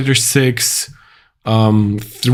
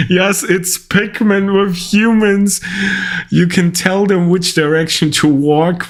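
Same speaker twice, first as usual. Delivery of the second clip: excited; 7 semitones higher than usual. Real, not acted. Delighted reaction to game pitch.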